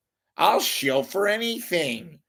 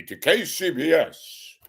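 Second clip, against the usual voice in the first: a hint of a stuffy radio announcer; puffed-up newsman tone, lightly done; stiff broadcaster voice. Radio host mocking a stuffy newsman, lightly voiced. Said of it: "I'm gonna talk like this".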